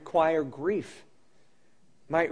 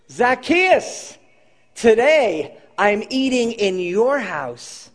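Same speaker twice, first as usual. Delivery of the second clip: calling up to Zacchaeus; 5 semitones higher, 5 dB louder, slower than usual. Acted. Jesus calls up to Zacchaeus.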